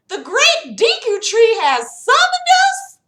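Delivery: high, loud gasp of disbelief; astonished question. Acted. Jenna reacts to the tree's summons, loud astonishment. Narrator voices her own startled question.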